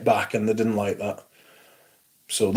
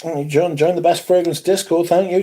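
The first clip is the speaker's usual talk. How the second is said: normal talking voice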